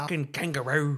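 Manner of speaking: broad fake Australian accent. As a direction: blurt it in mock Australian.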